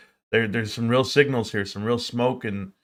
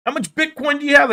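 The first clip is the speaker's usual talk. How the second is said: much higher and louder mocking question; squeaky hammed-up nosy older guy; older man asking; 6 dB louder, faster than usual. Older man presses the vlogger about his Bitcoin, in a high, loud, mock voice. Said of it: "I said".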